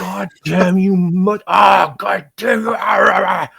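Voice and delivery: high, loud raging outburst; yelling at the computer while working alone; mock cursing at the computer. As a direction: rage at the screen, half shouting. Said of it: "terrible swearing thing".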